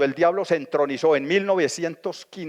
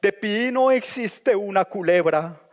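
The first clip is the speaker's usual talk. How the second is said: slow, lofty recited verse; grand poetry declamation; 4 semitones higher, slower than usual. Shown as a demonstration. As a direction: declaim the stanza slowly and grandly. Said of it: "le sacó el siguiente, la siguiente estrofa".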